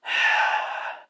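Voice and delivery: long, breathy exhale; weary sigh. Acted. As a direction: let out a long acted sigh. Character sighs during retold dialogue.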